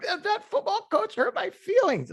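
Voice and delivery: high, whiny mocking voice; mock a player whining about hurt feelings.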